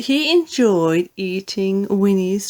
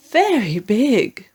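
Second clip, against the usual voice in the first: usual speaking voice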